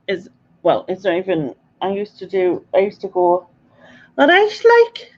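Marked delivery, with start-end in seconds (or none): 4.2-5.1 put-on Liverpool accent, much higher and louder. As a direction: put on a Scouse greeting, pitched way up. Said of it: "hoped it sounded a bit Liverpoolish".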